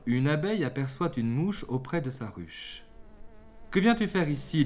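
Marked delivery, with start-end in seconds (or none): 3.7-4.6 mildly furious bee, pitched high. A narrator's reading. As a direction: demand it with a touch of storybook fury. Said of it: "lui dit-elle d'un ton furieux". (ton furieux mais contenu, plus aigu)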